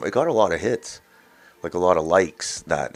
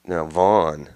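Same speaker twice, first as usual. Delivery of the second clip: normal talking voice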